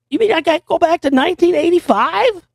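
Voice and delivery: mock Marty McFly, squeaky and incredulous. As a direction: sound stunned at going back in time. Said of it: "a fucking Marty McFly impersonation".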